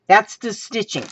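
ordinary delivery

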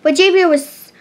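ordinary delivery, nothing marked

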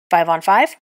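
loud, keen challenge to the group; eager to get the game going; eager call. Acted. Davies calls out eagerly to start the match. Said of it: "obviously eager to get started".

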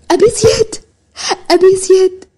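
high, loud cry of a sister seeing her brother; tearful joyful high call; overjoyed cry. Acted. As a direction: call out his name in joyful disbelief. Sister sees long-lost brother.

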